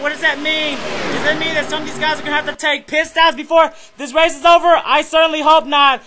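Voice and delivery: excited sim-race announcer on the final lap; live race play-by-play. Acted. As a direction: call the last laps live, building to a yell.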